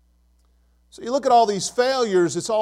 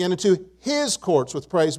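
small pause, then his picked out; stress on his. Shown as a demonstration. Preacher reading the psalm to congregation, his emphasized. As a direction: lean hard on the word his. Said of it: "I'm gonna emphasize the word his".

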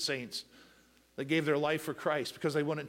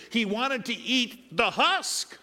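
louder, higher, slow and drawn out; loud emphatic preaching; 6 semitones higher, 5 dB louder, much slower than usual. Acted. Preacher driving home the son's hunger, loud and slow. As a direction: stretch out wanted to eat the husk with rising force.

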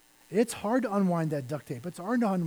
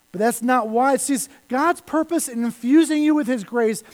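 usual speaking voice

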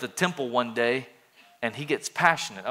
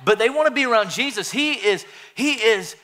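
excited; 7 semitones higher, 4 dB louder than usual. Real, not acted. Excited about Jesus.

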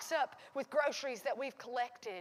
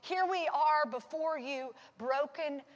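louder, higher, pleading prayer cry; full-force preaching; 4 semitones higher, 6 dB louder than usual. Acted. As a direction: pour it out at full volume. Closing prayer builds to full force.

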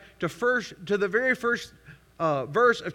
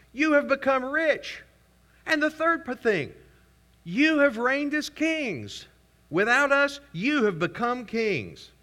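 high, slow mock reverence; sarcastic; 8 semitones higher than usual. Paul mocking Corinthians as kings, high slow sarcasm. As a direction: drag out the fake praise. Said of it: "Y'all hear the sarcasm".

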